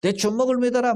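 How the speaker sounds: high mocking jeer, lightly imitated; taunting jeer. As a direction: needle the preacher with a high taunt. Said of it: "사람 약 올리고".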